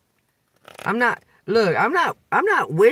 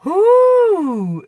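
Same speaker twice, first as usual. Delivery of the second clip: exasperated whew; 8 dB louder than usual. Real, not acted. Exasperated exhale mid rant.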